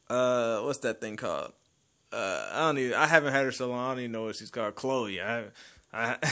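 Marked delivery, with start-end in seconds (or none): none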